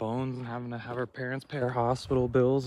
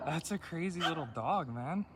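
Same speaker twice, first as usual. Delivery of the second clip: laughing at the frantic dog; 4 semitones higher than usual. Real, not acted. Amused by a dog tearing at the fence, laughing. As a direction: laugh through the words.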